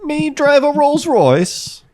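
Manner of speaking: very high, slow hammed-up character voice; silly high voice. Bragging about a Rolls Royce in a silly squeaky voice. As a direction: squeak it out like a cartoon posh fool.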